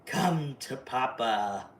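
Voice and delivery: low, very slow creepy welcome; menacing low invitation; Pennywise voice. Retold or imitated. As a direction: draw it out like a sinister father. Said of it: "he was like".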